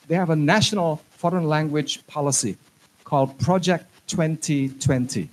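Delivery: normal talking voice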